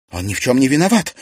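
loud, fast, high outcry; shout. A narrator's reading. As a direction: yell in her father's defence. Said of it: "крикнула Серафима". (крикнуть громко)

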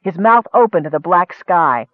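ordinary delivery

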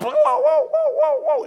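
high, loud "woo woo" police siren; mimicking a cop car siren; siren imitation. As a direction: wail like a siren.

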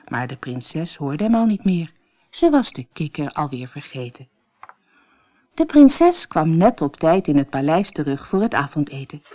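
usual speaking voice

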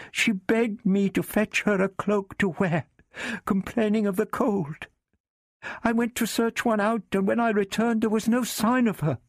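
put-on high, pleading young woman; frightened servant woman. A narrator's reading. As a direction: beg in a high scared voice.

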